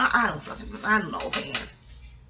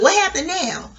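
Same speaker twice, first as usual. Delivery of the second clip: put-on confused old lady; 6 semitones higher than usual. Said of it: "be like".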